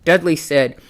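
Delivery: plain reading voice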